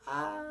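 a limp, half-hearted ah like the band singing in English; timid rock cry; weak rock yell. Shown as a demonstration. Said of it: "y en inglés es como".

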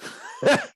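high, delighted laugh; burst of laughter. Real, not acted. Actor laughing at the great direction he got, delighted. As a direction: laugh out at the memory. Laughing at his own punchline.

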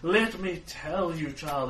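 slow, grand mystic pronouncement; fortune teller. Acted. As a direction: intone like a theatrical seer. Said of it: "she continues to pontificate".

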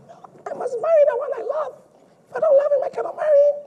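high, mocking falsetto of a young woman; mock girl's voice. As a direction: squeak it out like a stubborn bride. Mocking a modern daughter's voice.

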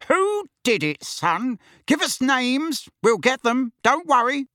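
high, blustering father; Uncle Vernon. Acted. Father promising revenge to his son, blustering. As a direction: bluster protectively at the boy.

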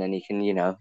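normal talking voice

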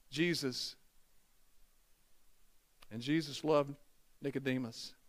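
low, soft, with long pauses; hushed solemn emphasis. Real, not acted. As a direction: let each phrase land softly. Preacher drops to hushed, solemn emphasis.

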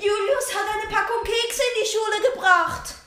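high telling-on voice; tattletale. Acted. Tattletale telling the teacher about the cookies. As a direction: tattle eagerly. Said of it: "die Petze".

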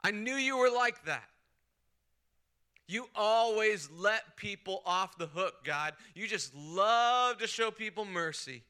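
mock furious outburst at God; furious Jonah. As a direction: yell the accusation, hammed-up fury. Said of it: "Jonah is furious at God's grace".

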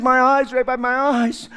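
a lightly voiced beggar begging; mild plea. Bartimaeus pleading with Jesus, mild plea. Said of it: "He says".

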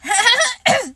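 sudden squeaky hiccup; a high little hup escapes; childlike accidental hiccup. Acted. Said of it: "said Roo accidentally".

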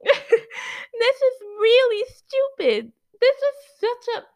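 slow, high, loud laughing frustration; loud exasperated laughing complaint; laughing exasperation. Real, not acted. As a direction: stretch it out half laughing, half fed up. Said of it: "frustrated at slow nurses".

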